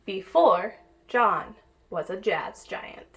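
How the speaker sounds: put-on lively read-aloud for kids; picture book reading. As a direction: read it like storytime for little ones. Reads picture book to children.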